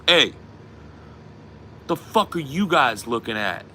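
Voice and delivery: imitating a friend's confrontational bark; acted drunk challenge. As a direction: pick a fight, mock drunk.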